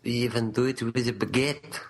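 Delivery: mock-romantic French accent. Co-host boasting for the romantic nation, hammed-up French accent. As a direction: ham up a French lover's accent. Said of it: "You're French".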